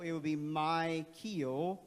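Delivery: "my keel" slow, long i, long ee; sounding out. Shown as a demonstration. Teacher sounding out colleague's name, slow. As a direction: draw out "my keel" slowly. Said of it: "What would it be?".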